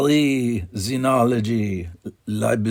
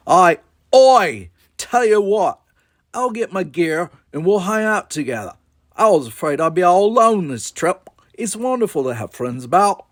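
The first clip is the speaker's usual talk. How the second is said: put-on high, loud Australian accent; 9 semitones higher, 6 dB louder than usual. A narrator's reading. Girl invites a new friend along, bold Australian accent. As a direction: pitch it way up, broad Australian. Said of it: "how do I do Australian?".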